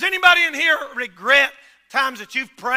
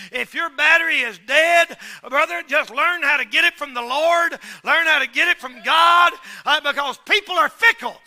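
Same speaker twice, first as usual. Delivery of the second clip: loud, higher sermon exhortation; preacher urging the congregation to rely on God; preaching at full force; 4 semitones higher, 6 dB louder than usual. Real, not acted. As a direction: preach it hard and rising.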